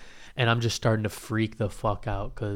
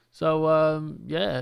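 higher, hesitant awkward host; awkward emcee; 4 semitones higher, much slower than usual. Retold or imitated. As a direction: imitate an awkward host filling dead air. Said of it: "he's, like, really awkward".

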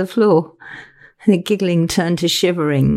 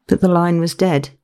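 ordinary delivery

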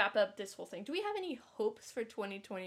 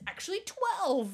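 excited; 8 semitones higher, 5 dB louder than usual. Real, not acted. Correcting the countdown playfully.

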